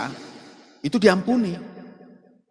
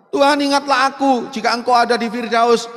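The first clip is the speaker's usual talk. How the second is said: pleading cry; 5 semitones higher, 7 dB louder than usual. Acted. Thief on cross pleads.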